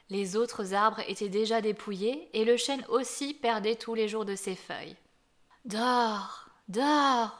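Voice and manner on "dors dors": lullaby of the winds. Acted. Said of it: "chantaient les vents autour de lui".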